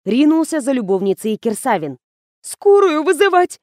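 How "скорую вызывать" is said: high, loud panicked cry; frightened, much higher and louder; trembling voice. A narrator's reading. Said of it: "дрожащим голосом пояснила Кира".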